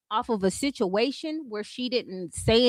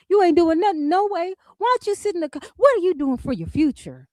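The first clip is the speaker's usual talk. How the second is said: high hammed-up TV ad scolding; mock commercial voice; 5 semitones higher, faster than usual. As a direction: parody a cheesy college ad. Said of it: "that's what she said, like one of them college commercials".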